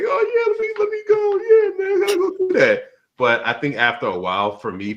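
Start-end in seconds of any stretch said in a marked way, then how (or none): 0.0-2.8 high-pitched eager mock voice. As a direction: squeal it like an excited kid. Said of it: "I'm like".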